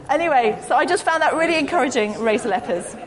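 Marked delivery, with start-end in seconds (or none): none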